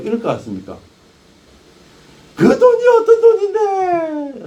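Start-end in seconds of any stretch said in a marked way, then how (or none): 2.4-4.5 high, loud, drawn-out screech; wailing protest, stretched out and piercingly high; outraged mock wail. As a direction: explode in hammed-up outrage over lost money. Imagined outraged victim of money loss.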